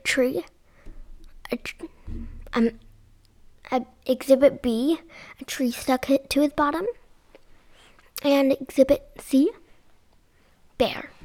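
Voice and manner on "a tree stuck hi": ordinary delivery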